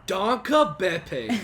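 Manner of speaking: loud, high, overdone American danke; mock the flat American way of saying it; American accent. Friend mocks his American-accented Danke, Beppe. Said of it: "You said it so American".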